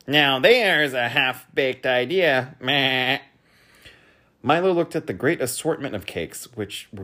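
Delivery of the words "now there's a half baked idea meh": slow, higher, loud voice; scoffing character. A narrator's reading. Diner scoffing at a cake's slogan, slow and loud. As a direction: drawl it out dismissively.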